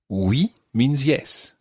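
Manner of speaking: slow and deliberate; slow, clear teaching. Shown as a demonstration. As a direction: spell it out slowly for learners. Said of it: "repeat that after me".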